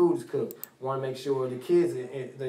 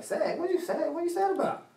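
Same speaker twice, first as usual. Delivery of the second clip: high, incredulous question with a laugh; playfully shocked at a child's answer; dad surprised that his daughter says she is sad, high amused disbelief. Retold or imitated. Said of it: "I'm like".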